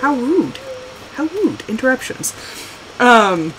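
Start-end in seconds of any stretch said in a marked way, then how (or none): 0.0-2.3 mock offended. Player chiding whoever interrupted her story, mock offended. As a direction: act primly affronted, half in play.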